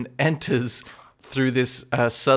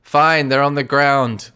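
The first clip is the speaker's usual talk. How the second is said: distant call; 3 semitones higher, 5 dB louder, much slower than usual. Acted. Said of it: "he says through, distantly through this chamber".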